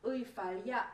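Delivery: reversed audio, soft and distant; backwards speech. Shown as a demonstration. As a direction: play the words in reverse. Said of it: "It sounds something like this".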